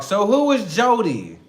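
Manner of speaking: loud acted interrogation; accusing. Girlfriend confronting partner about Jody, accusing. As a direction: demand an answer, pushing the name.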